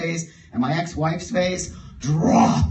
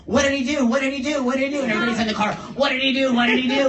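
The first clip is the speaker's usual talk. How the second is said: high, louder, frantic repeating; panicked chorus; 8 semitones higher, 4 dB louder, much faster than usual. Retold or imitated. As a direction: friends in the car demanding what he did, panicking. Friends panicking in car.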